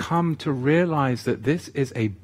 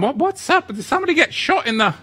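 alarmed question; 4 dB louder, faster than usual. Retold or imitated.

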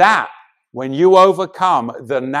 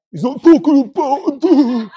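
high-pitched mocking voice; mock objector; 9 semitones higher, 4 dB louder than usual. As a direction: parrot them in a silly high voice. Mocking an objector's reading of scripture.

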